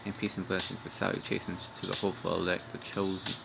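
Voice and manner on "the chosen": usual speaking voice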